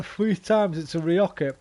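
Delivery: usual speaking voice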